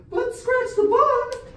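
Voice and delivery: very high, loud comic squeal; put-on Mandy voice. Friend changes order as Mandy, loud high put-on voice. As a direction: squeal it, drawing out the ending. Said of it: "You're doing your voice all wrong".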